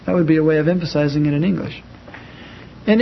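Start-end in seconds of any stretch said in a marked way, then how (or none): none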